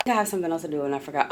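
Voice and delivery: ordinary talk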